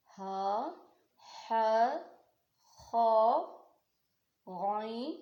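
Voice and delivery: strong throat sounds on each letter; reciting throat letters. Shown as a demonstration. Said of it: "कौन कौन से?".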